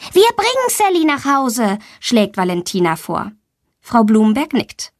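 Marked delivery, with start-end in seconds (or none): 0.1-1.8 put-on high eager little girl; a girl volunteering to her teacher, high and eager; child voice. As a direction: play the girl, bright and keen to help. Girl Valentina offers eagerly.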